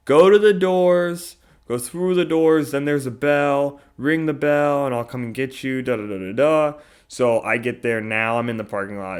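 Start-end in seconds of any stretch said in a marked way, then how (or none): 0.1-6.7 retold phone instructions. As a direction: mimic her phone directions, a bit raised. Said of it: "I heard her on the phone say".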